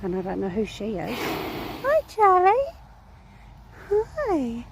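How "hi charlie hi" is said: very high, excited pet-talk hello; high sing-song greeting; cooing greeting. Real, not acted. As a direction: squeal hello to a favourite horse.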